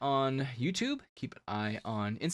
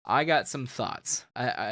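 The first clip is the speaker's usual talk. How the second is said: usual speaking voice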